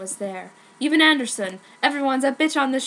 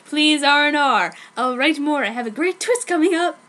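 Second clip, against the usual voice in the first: louder, higher eager plea; excited; 3 semitones higher, 5 dB louder than usual. Real, not acted. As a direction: pump up the readers. Eager author's note plea.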